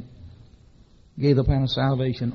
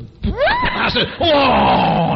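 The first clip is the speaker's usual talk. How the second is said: loud cry; 12 semitones higher, 7 dB louder, much slower than usual. Retold or imitated. Gas attendant arrives, friend erupts.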